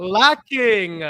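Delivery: "lacking" punched high and loud; hammed-up, high, drawn-out "lacking"; stress on lacking. Repeats the word for comic effect.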